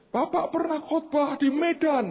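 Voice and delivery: high-pitched imitation of a pleading caller; imitated crying. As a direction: mimic her tearful insistence. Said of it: "dia nangis keras". (menirukan ibu yang memelas)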